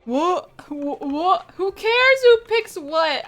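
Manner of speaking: rising, baffled "what", then a loud, slow, high "who cares"; mock outraged reply to a troll; exasperated retort. Retelling her reaction to a post, loud and exasperated. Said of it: "And I'm like".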